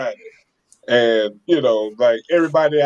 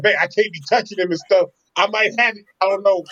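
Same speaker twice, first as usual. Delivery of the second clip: freaked out; 6 semitones higher than usual. Retold or imitated. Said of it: "I freaked out".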